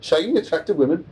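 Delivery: comic try at a Brummie voice; Birmingham accent. Shown as a demonstration. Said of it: "I have to tune in my Birmingham accent".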